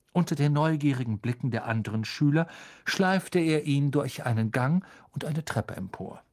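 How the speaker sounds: plain reading voice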